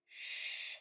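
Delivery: stretched unvoiced sh; quiet sh demo; voiceless sh. Shown as a demonstration. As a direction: hold a breathy sh. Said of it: "Sh is a voice off, stretchy sound".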